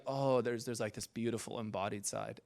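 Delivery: soft, low, wistful murmur; tender hush. Acted. Preacher voicing his other side, tender hush. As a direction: drop to a gentle, awed hush.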